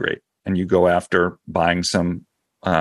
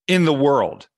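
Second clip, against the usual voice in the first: higher, punchy payoff; dramatic reveal; 5 semitones higher, slower than usual. Shown as a demonstration. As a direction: land the punchline big. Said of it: "wait for it".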